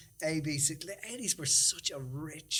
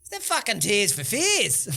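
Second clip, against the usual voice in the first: imitating a bloke; 6 semitones higher, 7 dB louder than usual. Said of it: "somebody goes".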